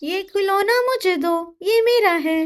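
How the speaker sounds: put-on high boyish bossy voice; a little brother grabbing a toy; child voice. Older brother demanding the toy from his sister, childish high voice.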